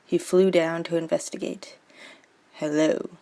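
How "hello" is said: put-on lower, suave young hero greeting; dashing voice. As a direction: play it as a dashing gallant bird. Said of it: "said Romeo in his best dashing voice".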